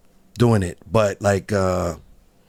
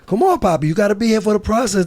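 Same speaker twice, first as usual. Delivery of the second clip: high, fast, coaxing voice; coaxing producer; 10 semitones higher, faster than usual. Retold or imitated. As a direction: sweet-talk him into staying, quick and bright. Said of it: "He's like".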